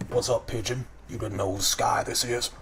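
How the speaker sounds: deep chest voice of a taunting crow gang leader; deep-voiced Kenku boss. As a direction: sneer at the newcomer from deep in the chest, like a tough bird boss. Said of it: "the deepest voice comes out of his chest".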